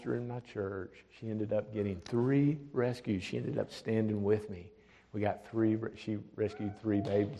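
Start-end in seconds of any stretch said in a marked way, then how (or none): none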